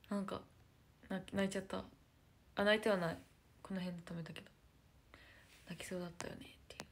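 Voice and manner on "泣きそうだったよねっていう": fast hushed whisper. Real, not acted. Whispering an admission to her viewers. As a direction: rush it out in a secret whisper.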